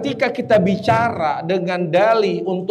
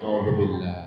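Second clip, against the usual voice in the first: low soft murmur; 12 semitones lower, 8 dB quieter than usual. Shown as a demonstration. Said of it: "bacanya apa".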